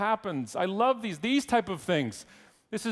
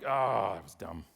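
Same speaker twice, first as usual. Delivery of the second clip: offhand put-down; 11 semitones lower, 4 dB quieter than usual. Retold or imitated. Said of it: "And you're like".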